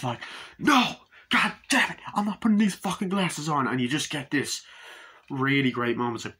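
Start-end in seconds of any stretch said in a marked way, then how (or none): 0.6-3.7 high, loud mock outburst; angry refusal. Friend refuses to wear the glasses, angry loud imitation. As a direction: burst out indignantly, imitating the stubborn friend.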